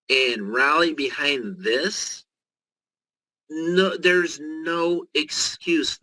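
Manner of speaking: drawn-out pitch with weight on each phrase; deliberately slow. Shown as a demonstration. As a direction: spell it out slowly so everyone grasps it. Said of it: "So I'm gonna try to say this slowly".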